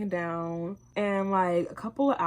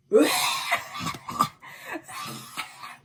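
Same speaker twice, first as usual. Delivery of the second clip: high, breathy burst of laughter; loud laugh; 10 semitones higher, 4 dB louder than usual. Real, not acted. Laughing out loud while telling her friend the story.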